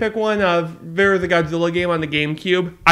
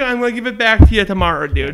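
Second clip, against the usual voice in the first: normal talking voice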